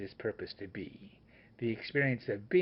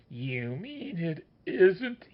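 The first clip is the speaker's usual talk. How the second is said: crying out; 5 semitones higher, slower than usual. A narrator's reading. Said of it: "he cries".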